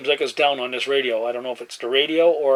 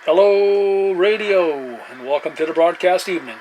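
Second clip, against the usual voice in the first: louder, higher announcer greeting; radio call-out; 4 semitones higher, 4 dB louder, slower than usual. Acted. CB operator greeting a local caller, booming announcer style. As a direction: welcome the new caller like a host.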